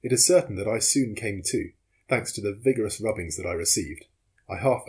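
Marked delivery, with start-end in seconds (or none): none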